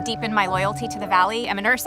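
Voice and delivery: ordinary talk, nothing marked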